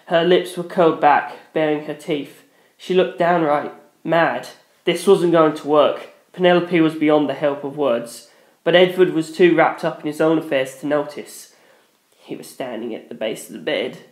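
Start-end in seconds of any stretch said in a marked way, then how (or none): none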